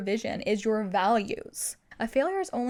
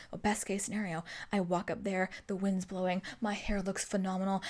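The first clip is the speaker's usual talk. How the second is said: lower, softer, mock-glamorous narration; dreamy self-glorifying fantasy; 3 semitones lower, 7 dB quieter than usual. Imagining her best case on stage, dreamy. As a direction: savour the fantasy in a hushed low voice.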